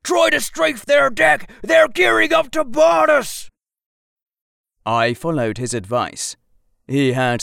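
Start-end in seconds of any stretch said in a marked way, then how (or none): 0.0-3.5 loud gung-ho soldier. Acted. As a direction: yell the order over battle noise. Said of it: "yelled the soldier".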